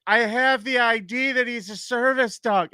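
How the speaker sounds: high, drawn-out heckler's protest; yelling heckler. Retold or imitated. Drunk woman arguing with the comic, high imitated yelling. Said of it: "the shit she was yelling".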